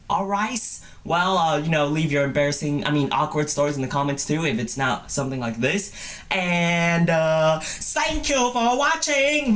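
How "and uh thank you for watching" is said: high, slow put-on outro; hammed-up drawn-out farewell; goofy sign-off voice. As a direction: stretch out the goodbye in a silly high voice.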